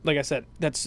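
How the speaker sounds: normal talking voice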